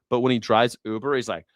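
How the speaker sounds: usual speaking voice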